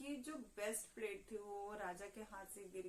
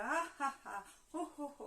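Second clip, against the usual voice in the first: loud hammed-up laugh ha ha ho ho; king Leonard roaring with laughter; king laughing louder; 4 semitones higher, 5 dB louder than usual. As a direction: belly laugh played big. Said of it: "laughing louder".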